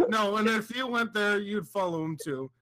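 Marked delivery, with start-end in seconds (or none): none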